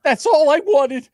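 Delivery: high, slow put-on whine; mock whine. Mimicking a clip line in a high whine.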